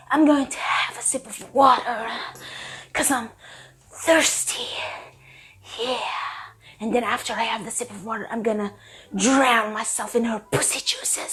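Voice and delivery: low, hushed, overly intense delivery; intense brooding murmur. Shown as a demonstration. Mocking the book's hero, a hushed, intense brooding murmur. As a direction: whisper it like a brooding dark romance hero. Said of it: "He would say it like this".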